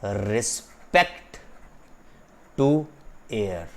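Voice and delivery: "water ... with ... respect ... to ... air" with a pause after each word; word-by-word reading. Shown as a demonstration. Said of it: "उसको आगे पढ़ा जाएगा". ("water with respect to air" शब्द-दर-शब्द)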